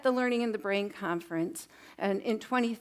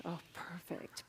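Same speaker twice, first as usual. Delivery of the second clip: whispered praise; 4 semitones lower, 16 dB quieter, slower than usual. Real, not acted. Softly praising class after shush.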